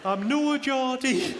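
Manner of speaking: high, stretched-out caricature of Sunderland speech; terrible Sunderland accent. Retold or imitated. Barmaid insists she's no Geordie, hammed-up Sunderland accent. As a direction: exaggerate a bad Sunderland accent, high and slow. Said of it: "that's a terrible accent".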